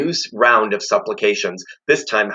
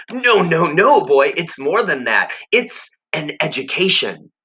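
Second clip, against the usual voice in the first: higher, insistent creature voice; a higher cunning mentor voice; insistent tempter; 7 semitones higher than usual. Acted. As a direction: correct the boy with sly conviction. Jive correcting Harvey.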